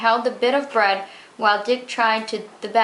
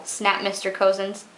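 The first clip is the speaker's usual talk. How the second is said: usual speaking voice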